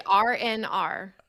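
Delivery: "R N R" spelt out slowly; slow, separated. Shown as a demonstration. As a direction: say "R N R" with each letter apart. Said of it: "but say it fast".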